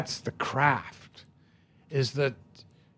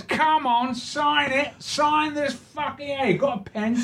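high, slow, rowdy cockney voice, much higher; cockney drunk; 13 semitones higher than usual. Retold or imitated. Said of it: "He goes".

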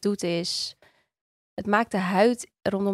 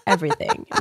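joking; 10 semitones higher, 7 dB louder than usual. Pretend. Joking mock answer.

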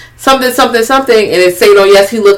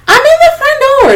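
very high squeaky mimicked voice; mimicked caller. Voicing Molly on the walkie-talkie, high squeaky mimicry. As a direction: pitch it way up, imitating the caller.

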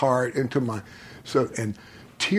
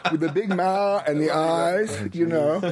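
laughing; 8 semitones higher than usual. Real, not acted. Laughing while describing Zardoz head.

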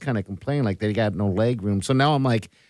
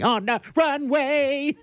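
high sing-song; 14 semitones higher, slower than usual. Pretend. Bird-call joke about runway.

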